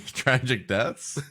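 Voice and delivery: ordinary talk, nothing marked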